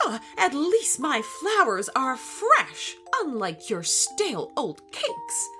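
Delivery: indignant retort. A narrator's reading. Gorilla hits back in quarrel.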